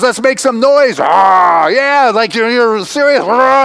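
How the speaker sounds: loud, high hammed-up war whoop; rally them into a growl; war cry. Retelling Tyler firing up Zach by the fire, loud mock war cry.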